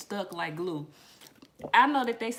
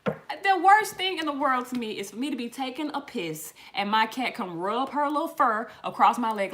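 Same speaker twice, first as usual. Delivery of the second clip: normal talking voice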